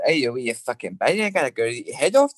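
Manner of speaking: quick, rough Scottish accent. Shown as a demonstration. Showing the hosts how Scots sound, mock Scottish accent. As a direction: put on a thick Scottish accent. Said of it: "going up to Scotland".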